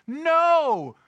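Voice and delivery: high, loud cry of disbelief; outraged yell. Retold or imitated. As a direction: explode like fans at a bad play. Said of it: "My whole couch exploded".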